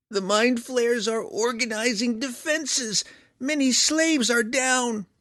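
very high, slow monotone creature; controlled creature voice. A narrator's reading. Hookhara reporting to his friends, high, slow, controlled voice. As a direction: hold one high pitch, slow and measured. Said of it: "The Hookhara's voice sounded more controlled".

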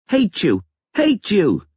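put-on very high little-boy cries; squealed small-child anger; child's tantrum. A narrator's reading. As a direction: fling it out like a furious five-year-old. Five-year-old boy's tantrum at father.

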